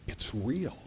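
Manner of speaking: quiet, stunned realization; dawning realization. Retold or imitated. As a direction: let it dawn on you softly. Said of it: "it suddenly occurs to Steve Martin".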